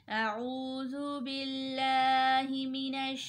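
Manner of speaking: teacher opens the lesson with a recited ta'awwudh; Quranic recitation. Shown as a demonstration. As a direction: chant it slowly and reverently. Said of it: "इसको शुरू करते हैं".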